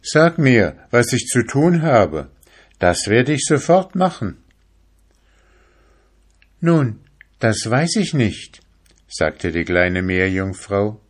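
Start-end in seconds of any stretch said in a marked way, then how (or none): 0.0-4.4 higher, louder, urgent asking; impatient young fisherman pleading; eager fisherman. Acted. Fisherman begs mermaid. 6.6-8.6 mermaid's voice. Acted. Mermaid replies.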